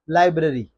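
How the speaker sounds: लाइब्रेरी as LAI-bruh-ree; short middle, falling end in लाइब्रेरी; correct pronunciation. Shown as a demonstration. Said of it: "genuine word है". (लाइब्रेरी सही, लाइ-ब्र-री)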